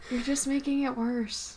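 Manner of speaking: very soft, withdrawn murmur; talking quietly. Real, not acted. Uncomfortable co-host to podcaster, very quietly. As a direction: say it well away from the mic. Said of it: "talking so quietly".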